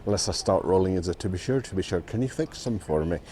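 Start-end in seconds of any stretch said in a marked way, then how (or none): 1.1-3.2 softer, self-mocking attempt at an Irish accent; bad Irish accent. Grandad asks the lads for a favour, in a deliberately bad Irish accent. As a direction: have a go at Irish and know you're failing. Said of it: "I can't do an Irish accent".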